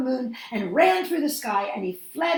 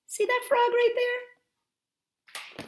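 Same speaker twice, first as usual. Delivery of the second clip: high playful; 14 semitones higher, much slower than usual. Real, not acted. Teacher showing picture to children.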